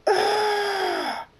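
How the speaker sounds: very high pained scream. A narrator's reading. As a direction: scream as the hoof is twisted. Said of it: "Stardust screams".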